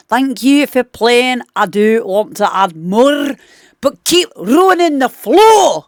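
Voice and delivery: mock Scottish voice, slowing on the last words; Scottish accent. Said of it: "I should have just done the accent".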